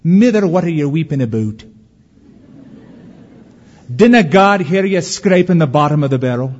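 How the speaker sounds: put-on little boy's Scottish accent; put-on Scottish lilt, earnest questioning; Scottish dialect. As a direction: play a Scottish child questioning his mother. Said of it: "said in his Scottish dialect".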